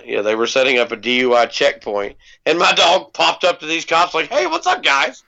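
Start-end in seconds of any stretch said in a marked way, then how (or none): 4.3-5.2 high, goofy dog voice; the friendly dog saying hi, pitched up; dog's voice. Pretend. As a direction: voice the dog greeting cops. Voicing the dog.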